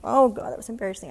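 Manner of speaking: ordinary talk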